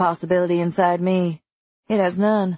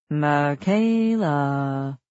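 low, drawn-out sing-song call; sing-song taunt; 4 semitones lower, much slower than usual. A narrator's reading. Creature calls hiding prey by name, sing-song. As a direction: chant the name slowly, like hide-and-seek. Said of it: "it says in a sing-song voice".

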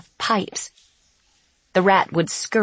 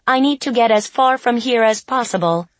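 robot voice; 4 semitones higher, 4 dB louder, faster than usual. Acted. Robot character voice, urgent.

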